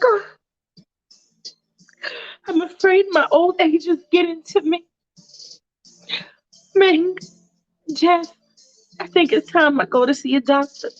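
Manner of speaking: an aging mom admitting her fears; lightly voiced worried mother. Mom figure in retold skit.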